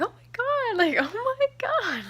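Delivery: very high, drawn-out mock squeal; shocked, giddy. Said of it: "just laughing, like, out of shock".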